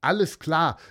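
eager call. Acted. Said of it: "rief Malte".

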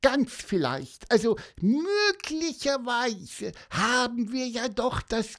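very high squeaky bird, slowing down dreamily; hopeful bird voice. Acted. Pieper hopes for cake on the way to the café, slow high chirp.

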